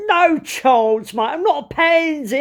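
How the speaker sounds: loud, high Cockney dismissal. Retold or imitated. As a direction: scoff in broad East End. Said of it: "And the Cockney went".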